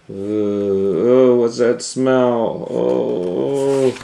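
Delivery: hammed-up disgust at a smell; mock disgusted sniff. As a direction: sniff out the stink in horror.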